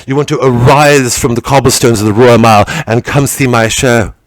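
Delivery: usual speaking voice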